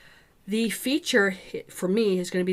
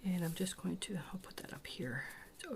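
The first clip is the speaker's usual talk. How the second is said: whispering; 15 dB quieter than usual. Real, not acted. Quietly working while painting.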